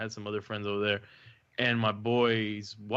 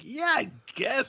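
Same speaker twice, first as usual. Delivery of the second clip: slow, higher sheepish yeah; 13 semitones higher, slower than usual. Retold or imitated. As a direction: admit it awkwardly, dragging it. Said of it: "And I'm like".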